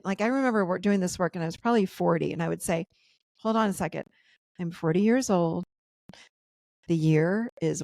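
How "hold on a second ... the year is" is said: measured self-talk with pauses between phrases; steady yourself, one phrase at a time; nice and slow. Shown as a demonstration. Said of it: "you gotta say it nice and slow".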